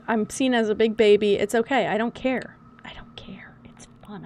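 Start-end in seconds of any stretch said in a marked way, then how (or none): 2.8-4.2 soft breathy whisper; hushed playful whisper. Real, not acted. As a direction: whisper it like a secret. Whispered aside.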